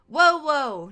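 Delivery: grido acuto e forte di sorpresa; startled yelp. Acted. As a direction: guaito spaventato, molto più forte e alto. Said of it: "he yelped".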